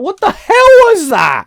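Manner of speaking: shocked shout. Real, not acted. Shocked at own old voice.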